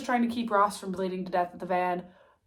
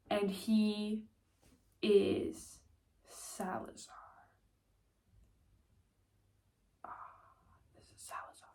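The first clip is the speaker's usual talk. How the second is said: fading to a hushed whisper; dramatic whisper; 8 dB quieter than usual. Acted. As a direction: drop to a breathless secret. Big plot twist reveal.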